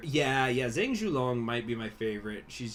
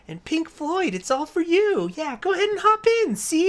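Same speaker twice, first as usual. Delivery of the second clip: put-on very high, loud, wheedling child voice; high, pushy little-girl sales pitch about beer; 9 dB louder than usual. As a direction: coax him toward the van like a sweet little girl. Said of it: "you just hear this little girl's voice".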